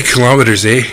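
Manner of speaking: lightly sultry Canadian kilometers, eh. Pretend. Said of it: "if I say it, like, sultry".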